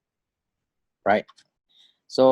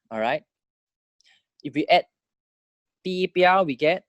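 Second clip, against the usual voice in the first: ordinary delivery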